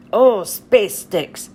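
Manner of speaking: a grumbling cartoon space pirate; Pete voice. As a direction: Pete cursing his luck, low and drawn out. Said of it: "says Space Pirate Pete".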